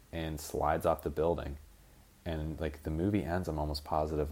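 normal talking voice

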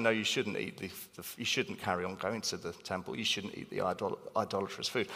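quiet, low verdict; soft verdict. Retold or imitated. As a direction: let Paul's answer drop to a hush. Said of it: "which interestingly is actually".

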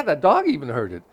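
usual speaking voice

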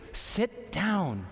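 a hint of a stern father saying "Sit down"; firm dad-style "Sit down"; lightly voiced parent telling the kids to sit; fatherly voice. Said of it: "That's my fatherly voice coming through".

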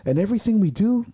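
put-on yelling like at a girlfriend. Lifter yelling at his sport about his sacrifices, furious. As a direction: spell out every sacrifice in anger. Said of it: "I'm yelling like I'm talking to a girlfriend".

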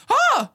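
high, loud mock gasp of shock; startled gasp. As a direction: imitate someone jumping in fright. Imitates startled woman turning around.

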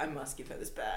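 lightly voiced robot, low and quiet. Quoting the robot about the bag, understated robot voice. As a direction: intone it softly as a single-minded robot. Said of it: "The robot is like".

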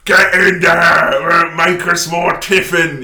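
loud, slow barked orders; angry shout. Retold or imitated. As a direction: bellow at the workers. Landlord whipping Catholics.

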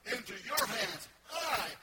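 loud, high, shouted proclamation; full-force preaching. Real, not acted. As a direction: preach with all your voice. Preacher voicing Jesus, full force.

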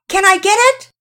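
shouted call to Mama in the kitchen; yelling out. A narrator's reading. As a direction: holler it from the front door. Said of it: "right after yelling out".

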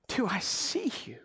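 whispered, intimate question; God whispering to an anxious child; tender whisper. Acted. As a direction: breathe it like a secret to a loved one.

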